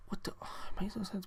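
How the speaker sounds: breathy sarcastic whisper; conspiratorial whisper to the viewers; whispered aside. Real, not acted. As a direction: let the punchline slip out under your breath. Exasperated aside.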